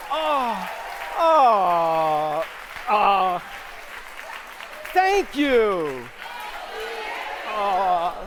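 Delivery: loud, high swooning oh's over applause; mock-bask in the ovation; hammed-up star soaking in applause; overwhelmed gushing. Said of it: "return it to me and say".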